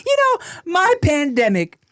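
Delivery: doting neighbor voice. Acted. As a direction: play the nosy, doting neighbor. Voicing the neighbor.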